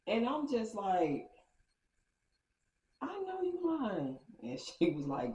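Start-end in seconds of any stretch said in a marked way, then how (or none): 3.0-4.2 very high, slow, drawn-out incredulity; squealed-up, lingering retold disbelief; acted disbelief. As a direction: stretch it out high in mock disbelief. Acting out own disbelief at gossip.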